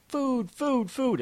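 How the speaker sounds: put-on high, eager little-boy cry of food; toddler demanding food. Son at McDonald's begging for fries, high childish demand. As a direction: mimic a hungry toddler grabbing at fries. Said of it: "He says".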